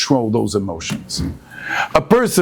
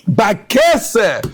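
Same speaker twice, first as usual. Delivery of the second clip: shouted keyword; 10 semitones higher than usual. Acted. Rabbi proclaiming the verse word to his class, shouted. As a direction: hurl the word Bakese at them.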